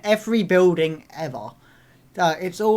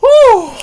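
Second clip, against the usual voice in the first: loud, high-pitched "whew"; excited whoop; 17 dB louder than usual. Real, not acted. Viewer reacting to the scene, loud high whoop. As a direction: let out a big whoop.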